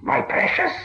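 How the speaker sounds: high, rasping whispered creature voice; raspy whisper to his precious; Gollum voice. A narrator's reading. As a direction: hiss it like a wretched cave creature. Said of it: "he said".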